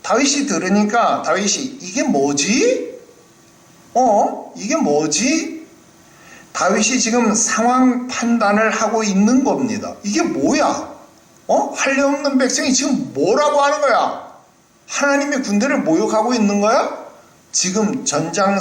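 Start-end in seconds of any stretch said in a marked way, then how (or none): 1.8-5.7 high, slow puzzled questioning; David hears the giant and asks what is going on. Acted. David puzzling over Goliath's taunt. 10.0-17.0 high, rising disbelief; young David outraged that God's army is mocked; incredulous David. David incensed at the insult.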